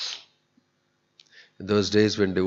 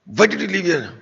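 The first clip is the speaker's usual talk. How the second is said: higher, louder, the rich man's indignant demand; retold angry outburst; 10 semitones higher, 4 dB louder than usual. Rich man scolding the young engineer, angry and raised. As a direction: imitate his angry challenge, pitched up. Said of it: "he suddenly became more and more angry".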